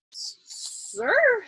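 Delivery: high, stammering disbelief; stammered. A narrator's reading. Young lieutenant stammering at the order, high and shaken. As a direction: stutter it out in shock. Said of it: "his chauffeur and meteorologist stammered".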